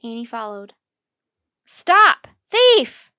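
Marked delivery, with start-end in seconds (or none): 1.6-3.1 high loud yell. A narrator's reading. Boy yelling after Jack through the street. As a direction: bellow it after the runners. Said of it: "the boy yelled".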